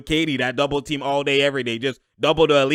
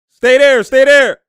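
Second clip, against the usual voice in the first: higher, louder, willing the ball in; urging shout; 10 semitones higher, 12 dB louder, slower than usual. Real, not acted. Streamer begging a shot to drop, loud urging. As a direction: shout at the screen to make it stay.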